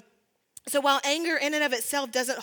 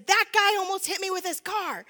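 mock yell, pitched higher; retold yell; 7 semitones higher, 3 dB louder than usual. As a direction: lightly re-enact the friend shouting in shock. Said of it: "and she's yelling".

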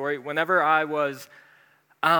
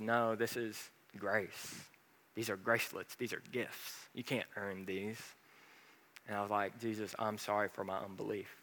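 hushed; 5 semitones lower, 12 dB quieter than usual. Retold or imitated. God's gentle words, quiet.

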